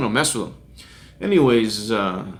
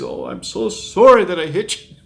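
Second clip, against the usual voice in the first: acted apology; 6 semitones higher, 3 dB louder than usual. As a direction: imitate a driver who can't stop apologizing. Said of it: "he's just apologized".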